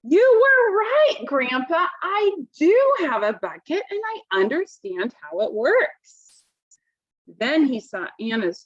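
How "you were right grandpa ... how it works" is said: high, slow, loud burst of joy; bursting through the door with big news; excited shout. A narrator's reading. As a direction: call out the news to Grandpa, thrilled. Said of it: "he shouted".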